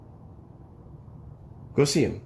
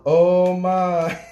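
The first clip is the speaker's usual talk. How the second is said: mock yell; 5 semitones higher, 7 dB louder than usual. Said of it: "Mine just yelled".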